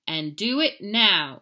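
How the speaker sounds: slow, loud firm order. Acted. Mama ordering Skippy to return the bones now. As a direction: spell out every word of the command. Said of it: "ordered Mama".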